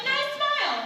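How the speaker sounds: understated shout, pitched well up; mild crowd shout. A narrator's reading. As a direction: call it out high like a cheering crowd. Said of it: "The crowd shouted".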